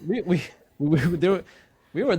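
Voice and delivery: amused, laughing while you finish. Real, not acted. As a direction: talk through leftover laughter. Still laughing while continuing.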